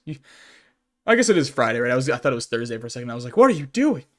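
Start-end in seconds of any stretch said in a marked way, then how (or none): 3.4-4.0 high, loud self-questioning outburst; mock disbelief. Streamer quoting their own mix-up over the day, mock disbelief. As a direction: blurt it out in mock alarm. Acts out startled reaction to oneself.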